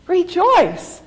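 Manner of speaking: loud, high cry of Rejoice; exultant call. Acted. Preacher calling the congregation to rejoice, loud and exultant. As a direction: call out Rejoice. with full force, falling away.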